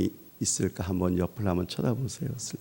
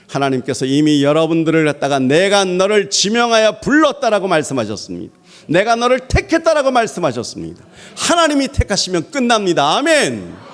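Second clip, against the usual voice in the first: 1 fiery preaching; 10 semitones higher, 10 dB louder than usual. Acted. Preaching at full force.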